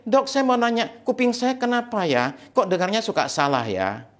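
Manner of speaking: lightly voiced wife asking, pitched high; worried patient. Wife consulting the ear doctor, puzzled. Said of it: "istrinya itu ke dokter T H T".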